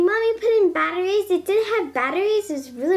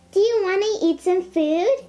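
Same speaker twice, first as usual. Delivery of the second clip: ordinary delivery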